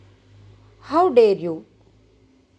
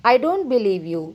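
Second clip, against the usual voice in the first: ordinary talk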